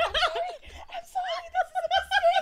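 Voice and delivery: very high, squealed apology with a laugh in it; mock panicked apology. Reenacting accidental call to 911.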